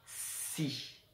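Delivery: "Si" softly, with a long hissed s; drawn-out sounds. Shown as a demonstration. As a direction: hold the s of "Si", then release the i. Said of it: "tu vas bien écouter".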